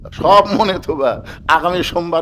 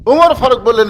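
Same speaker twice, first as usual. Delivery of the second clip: usual speaking voice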